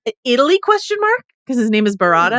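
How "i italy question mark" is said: high, questioning guess; tentative answer swooping up high at the end; mock uncertain answer. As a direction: voice a search result that is unsure. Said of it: "the internet was like".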